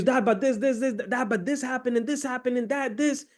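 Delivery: high, breathless-sounding rush of words; acted-out kid gushing; excited babbling son. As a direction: babble eagerly over Dad in a high voice. Excited son keeps babbling to dad.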